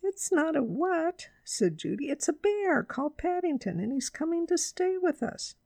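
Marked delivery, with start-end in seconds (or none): 0.0-1.3 high, slow correction; high-pitched, insistent girl; girl Judy. A narrator's reading. Narrator voices Judy. 2.1-5.5 put-on high-pitched girl's voice; high, eager explanation; girl Judy. A narrator's reading.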